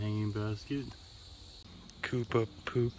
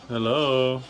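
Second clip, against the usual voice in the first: loud hello; 2 semitones higher, 8 dB louder than usual. Pretend. Greeting the cactus loudly.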